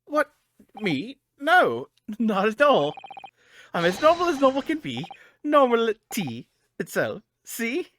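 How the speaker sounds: high, loud, slow nervous denial; acting strangely. Lawyer caught out by his assistant, insisting too hard in a high, loud voice. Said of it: "You're acting extremely strangely".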